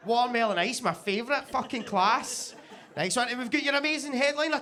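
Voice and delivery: ordinary delivery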